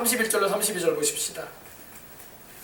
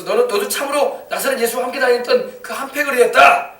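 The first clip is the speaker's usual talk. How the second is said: raised, high accusing voice; loud accusation; 6 semitones higher, 5 dB louder than usual. Acted. Bystanders denouncing Peter as one of the gang, accusing. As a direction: denounce him loudly and emphatically.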